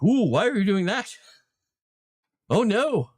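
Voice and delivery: high, loud mock alarm at a bad habit; mock dismayed groan. DM recalls reacting to another DM's distracting quirk, high and loud in mock dismay. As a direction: play the horrified player watching a DM's quirk.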